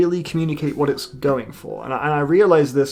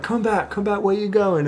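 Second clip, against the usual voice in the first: higher, lightly acted plea to come back; pleading boyfriend; 6 semitones higher than usual. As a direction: call after her, a hint of a desperate boyfriend. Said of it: "Chris is like".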